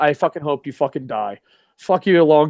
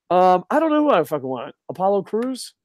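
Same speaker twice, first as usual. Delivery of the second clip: ordinary talk, nothing marked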